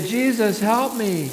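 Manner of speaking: prompted call. Shown as a demonstration.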